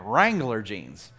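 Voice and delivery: low, slow country drawl; mock country drawl. Shown as a demonstration. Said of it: "you have to say it like that".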